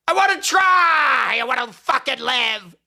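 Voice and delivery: real anguished slow shout; desperate shout. Real, not acted. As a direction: cry out that you want to live. Desperate outburst about life.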